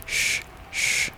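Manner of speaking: soft, voiceless hushing hiss; shushing. A narrator's reading. Hatter and Hare silencing Alice, shushing. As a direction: hush her with two breathy shushes. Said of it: "the March Hare went".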